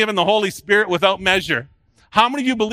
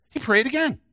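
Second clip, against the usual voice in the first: much higher pitch, lifting on "again"; emphatic repeat; 7 semitones higher than usual. Real, not acted. Preacher repeating that Jesus prayed again, emphatically raised. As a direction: drive the point home with a raised voice.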